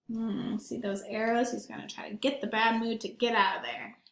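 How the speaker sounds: usual speaking voice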